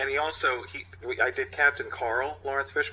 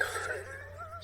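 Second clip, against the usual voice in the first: squeaky laugh; 10 dB quieter than usual. Real, not acted. Laugh breaking into the read.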